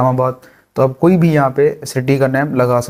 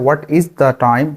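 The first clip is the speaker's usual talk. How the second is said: slow full form; much slower than usual. Shown as a demonstration. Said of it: "contraction use कर रहे हैं".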